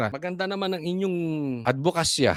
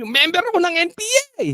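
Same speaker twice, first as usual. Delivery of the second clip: mocking quote; 12 semitones higher, 4 dB louder, faster than usual. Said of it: "Sabi nyo".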